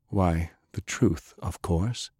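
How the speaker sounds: slow, soft and quietly amused; calm smiling answer. A narrator's reading. Captain calmly tells his partner the truth. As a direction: let the smile come through, unhurried.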